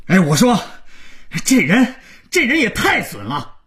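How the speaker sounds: high-pitched, exasperated phone rant; outraged caller losing it; frantic rage. A narrator's reading. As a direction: rant as if driven mad. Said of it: "刘总在电话那头已经抓狂了".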